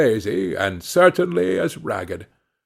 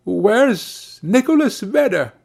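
slow, higher-pitched puzzled question; inquiring; 7 semitones higher, slower than usual. A narrator's reading. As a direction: ask slowly, searching for an old friend. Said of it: "and inquired".

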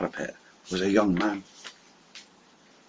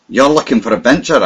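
higher and louder, fired up; eager, rousing exclamation; excited cry; 4 semitones higher, 6 dB louder than usual. Acted. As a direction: cry it out with zeal. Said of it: "I cried".

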